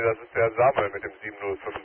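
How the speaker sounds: ordinary talk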